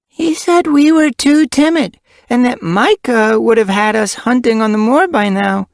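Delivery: put-on young kit voice.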